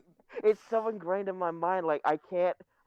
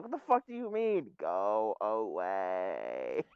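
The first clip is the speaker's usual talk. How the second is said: Elliot voice; 10 semitones lower, 4 dB quieter, slower than usual. Retold or imitated. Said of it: "Elliot's like".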